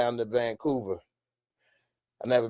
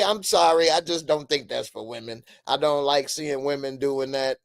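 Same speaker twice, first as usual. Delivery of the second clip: normal talking voice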